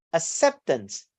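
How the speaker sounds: "acceptance" stressed on CEP, falling after; second-syllable stress. Shown as a demonstration. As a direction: lean on the middle syllable of "acceptance". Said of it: "primary stress on the second syllable".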